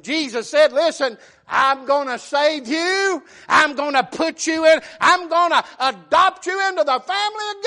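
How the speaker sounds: high, shouted preaching climax, slowing on key lines; preaching at full force. Acted. Preacher roars to the church about God's adoption. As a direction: build to a roaring altar call.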